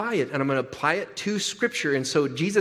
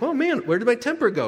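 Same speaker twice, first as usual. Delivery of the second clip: higher, mock-puzzled whine; mock bewilderment; 8 semitones higher, 3 dB louder than usual. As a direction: play dumb about the lost temper. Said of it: "It's like".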